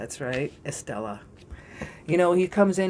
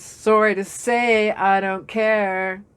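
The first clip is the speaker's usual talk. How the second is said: defiant; 3 semitones higher, 5 dB louder, slower than usual. Real, not acted. Defiant aside.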